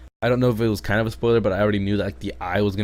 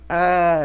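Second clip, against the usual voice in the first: mock scream; 10 semitones higher, 7 dB louder than usual. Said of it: "and he's just like".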